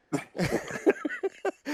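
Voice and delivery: high-pitched real chuckle; laugh. Real, not acted. Co-host cracking up at the race director joke, high laugh. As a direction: burst into a short, high laugh.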